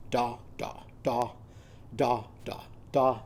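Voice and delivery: quiet bleating da sounds; soft sheep bleat. Shown as a demonstration. Teacher softly bleating sheep sounds for kids. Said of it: "our sheep are going to go ba ba".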